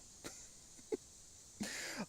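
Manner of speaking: high unvoiced breathy laugh. Real, not acted. Laugh after exclaiming.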